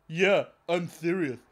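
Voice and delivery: loud, slow mock-official voice; hammed-up officer laying down the law; authoritative voice. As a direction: declare it with puffed-up authority. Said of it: "with a very authoritative voice".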